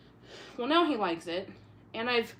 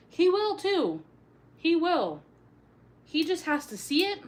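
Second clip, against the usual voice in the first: excited; 7 semitones higher, 6 dB louder than usual. Real, not acted. Excited about cat eating spider.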